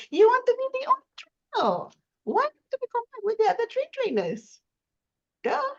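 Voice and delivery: high, mocking duh tone; sarcastic squeaky rhetorical question. Real, not acted. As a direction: pitch it way up to mock the idea. Mocking rhetorical point, pitch jump.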